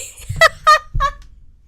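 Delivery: a high, wheezing squeal of laughter. Real, not acted. Trying to finish the sentence, high squealing laugh. Still cracking up.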